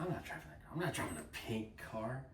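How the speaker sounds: soft, macho Southern refusal; lightly imitated Southern husband; Southern accent. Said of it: "he had that southern accent too".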